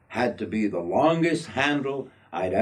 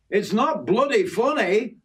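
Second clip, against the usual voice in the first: higher-pitched protest in broad Yorkshire; broad Yorkshire accent; 10 semitones higher than usual. A narrator's reading. Yorkie objects to Jim's laughter, broad Yorkshire accent. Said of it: "reverting back to my broad Yorkshire accent".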